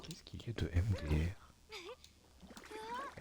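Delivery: slow, breathy whispered mock voice; hushed slow mock reading of the chat; slow put-on whisper. Shown as a demonstration. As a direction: breathe the question out slowly in a put-on voice. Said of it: "Est-ce que c'est mon accent raciste?".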